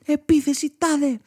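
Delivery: high, full-force mock anime attack yell; shouted battle cry. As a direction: scream it like a kid commanding a spinning top. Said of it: "φώναζα".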